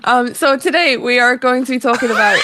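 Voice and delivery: laughing through a put-on Scottish accent; mock Scottish accent. Host laughing as she keeps the mock Scottish accent. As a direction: try to start the show, cracking up in Scottish. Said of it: "Still doing it".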